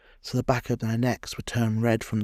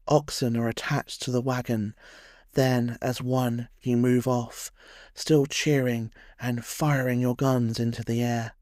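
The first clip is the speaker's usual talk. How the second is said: ordinary delivery, nothing marked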